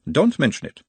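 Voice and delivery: put-on high, probing analyst in Uberwald accent; Uberwald-accented therapist, pitched well up; mild Uberwald accent. A narrator's reading. Nutt as analyst asks about his mother, Uberwald accent. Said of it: "mild Uberwaldian accent".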